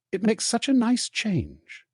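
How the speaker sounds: high, slow and gleeful, savouring it; drawn out like a treat being tasted; teasing twin enjoying the show; smug delight. Acted. Fred savouring mum scolding others.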